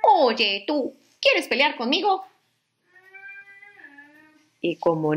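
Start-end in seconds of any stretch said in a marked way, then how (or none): 0.0-2.2 higher, grumpy little bug voice; grouchy ladybug. Acted. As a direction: taunt it like a cranky ladybug. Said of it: "la mariquita malhumorada le dijo".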